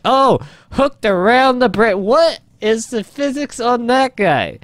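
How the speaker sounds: high, drawn-out disbelief; can't believe what just happened; incredulous amazement. Real, not acted. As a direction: stretch the words in astonishment. Amazed at the game physics.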